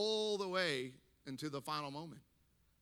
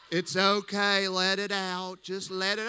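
consoling nurse; 3 semitones higher, 8 dB louder than usual. Retold or imitated. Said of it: "hospice nurse puts her hand".

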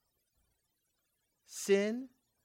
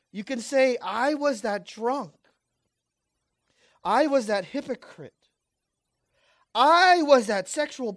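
loud, high confession litany; preaching at full force; 5 semitones higher, 11 dB louder than usual. Acted. Preacher voicing sinners' confessions to the church, loud. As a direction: hammer each I was.

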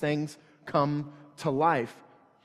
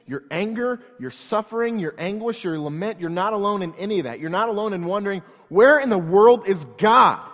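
rising, louder preacher's build; preaching at full force; 4 semitones higher, 6 dB louder than usual. Acted. Pastor assuring the church they are not alone, building preach. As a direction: drive it home to the congregation.

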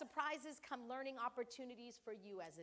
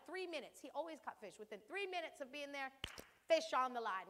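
ordinary talk

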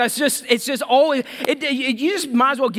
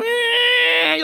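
very high, squealing whistle on "Eh"; tea kettle whistle; 13 semitones higher, 3 dB louder than usual. Retold or imitated. Preacher mimics a whining complainer as a kettle, high squeal. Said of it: "reminds me of, like, the tea kettle".

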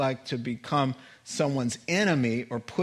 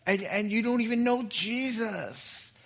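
higher, earnest scolding; retold rebuke; 7 semitones higher than usual. Pastor scolding the chaplain, pitched high. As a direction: warn him he will answer for it.

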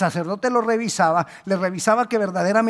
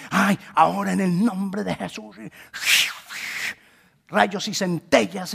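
mock-grandiose healer. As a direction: parody a showy miracle-worker, slowing down. Mock showy healing command, contrasted with simplicity. (parodia teatral de sanador)

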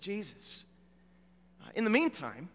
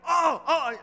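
higher, louder sudden oh of dismay; sudden realization; 7 semitones higher, 5 dB louder than usual. Retold or imitated. Pastor realizing he forgot the rings, alarmed. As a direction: catch the mistake all at once.

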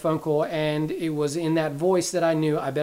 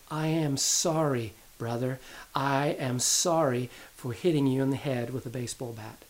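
put-on a boy quietly owning up to his brother; sorry child; 4 semitones lower, 6 dB quieter than usual. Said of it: "and he was saying".

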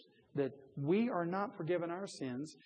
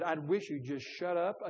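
ordinary talk, nothing marked

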